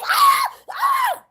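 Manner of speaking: very high-pitched mock scream; exaggerated falsetto fighting yell; mock battle scream. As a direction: shriek like you're fighting for your life, hammed up. Mimes fighting for your life.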